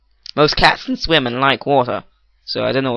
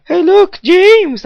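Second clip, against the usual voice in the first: very high, loud imitated holler; mock calling out; 7 dB louder, much slower than usual. Stranger hailing him at the shop, mock high shout. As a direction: call out like an excited stranger spotting someone.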